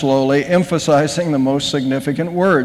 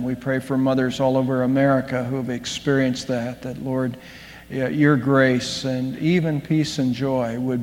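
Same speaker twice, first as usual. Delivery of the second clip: soft, earnest pulpit prayer, sinking quieter; 6 semitones lower, 5 dB quieter than usual. Acted. Pastor praying for mothers before the sermon, hushed and earnest. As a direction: pray over the congregation, hushed and sincere.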